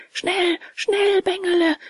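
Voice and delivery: high, urgent goat's cry; bleating goat. A narrator's reading. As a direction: bleat a pressing warning in a high voice. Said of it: "meckerte die Ziege".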